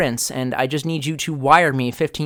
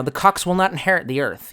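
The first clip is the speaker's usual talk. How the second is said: ordinary talk, nothing marked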